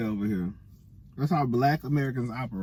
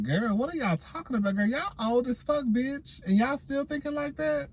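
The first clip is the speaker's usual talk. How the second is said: incredulous; 10 semitones higher than usual. Retold or imitated. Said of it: "And I was just like".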